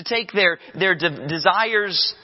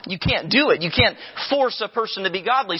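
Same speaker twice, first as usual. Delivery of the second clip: ordinary delivery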